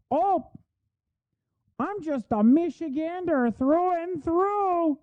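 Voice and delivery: sneering yokel parody; mock folksy Michigander. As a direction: ham it up as a fake down-home politician. Parodying the senator's folksy persona.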